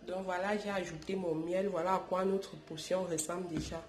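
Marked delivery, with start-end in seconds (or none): none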